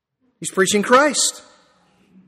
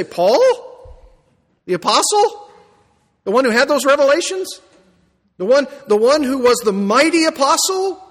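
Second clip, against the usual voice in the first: rising higher with each disbelieving question; "Paul? The apostle?" asked in mock disbelief, pitched higher; incredulous questions; 7 semitones higher, 3 dB louder than usual. Preacher voicing a doubting listener, incredulous and higher. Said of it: "You say".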